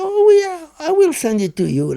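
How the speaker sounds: high, sing-song mock; lightly voiced sneering doctor. Prisoner imitating the prison doctor, mild sing-song mockery. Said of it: "He said".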